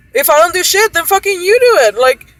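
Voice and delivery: high, loud, indignant retold tirade; angry retold outburst; heated venting. Retold angry complaint to the executive.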